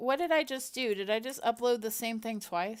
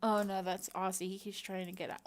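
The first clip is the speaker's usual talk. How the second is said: ordinary talk, nothing marked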